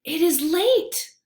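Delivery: a small worried squeak; squeaky mouse. A narrator's reading. Mouse frets to friends in the lair, mildly squeaky. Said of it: "Mouse squeaks".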